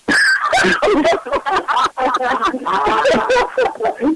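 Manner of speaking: big high burst of laughter; howling laugh at his story. Real, not acted. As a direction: explode into laughter.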